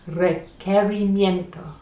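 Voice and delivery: Requerimiento said with a clear K sound, every syllable spoken out; modelled pronunciation. Shown as a demonstration. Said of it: "Try saying that".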